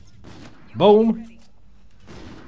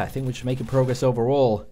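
ordinary talk

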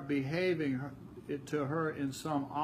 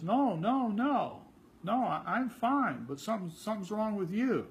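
defensive denial; 6 semitones higher than usual. Retold or imitated. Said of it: "And she says".

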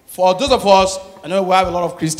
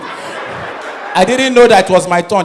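ordinary talk, nothing marked

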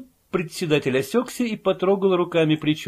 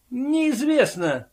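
spiteful answer; 9 semitones higher, much slower than usual. A narrator's reading. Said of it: "злобно ответил загадочный регистратор".

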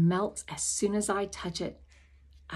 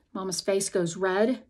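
ordinary delivery, nothing marked

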